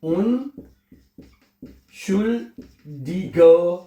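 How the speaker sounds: "Unschuldiger" stretched long and slow, every syllable held; drawn-out word. Shown as a demonstration. Teacher stretches the new word form for learners, very slowly. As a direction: draw out "Unschuldiger" slowly for the students. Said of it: "unschuldiger Fehler".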